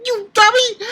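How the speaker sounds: very high, loud imitation of an angry girl; mock outraged squeal. Reviewer imitating Asuna before she stabs him, high mock outrage. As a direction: shriek it like an offended girl.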